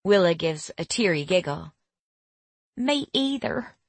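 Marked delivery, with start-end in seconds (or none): 2.8-3.8 high, slow Southern twang; Southern accent. A narrator's reading. Tearful girl admits the same, high slow Southern accent. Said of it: "her voice twangy with a southern accent".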